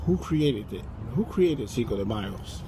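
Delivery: low, soft boardroom inquiry; hushed, low questioning; subdued questioning. Acted. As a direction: ask it very quietly.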